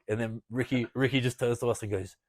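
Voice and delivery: ordinary delivery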